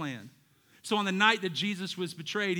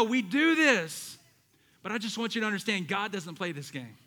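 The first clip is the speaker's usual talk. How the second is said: normal talking voice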